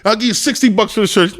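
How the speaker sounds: lightly mimicked eager customer; imitated picky customer. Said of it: "He's like".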